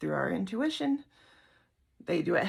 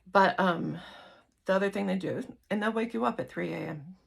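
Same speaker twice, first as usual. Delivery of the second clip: normal talking voice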